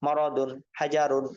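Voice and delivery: slow whole-word reading of "মরদুন, হাজারুন"; measured demonstration of "মরদুন, হাজারুন" as full words; reading style. Shown as a demonstration. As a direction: read "মরদুন, হাজারুন" slowly, each word unbroken. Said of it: "এরপরে reading.".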